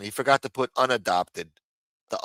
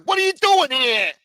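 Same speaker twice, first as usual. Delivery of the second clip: high, louder put-on snarl; mock hostile man; 7 dB louder than usual. Mocking the mugshot man confronting a reporter, high and loud. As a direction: bark it like a suspicious old mobster. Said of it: "This is how he talks to the fucking Daily News".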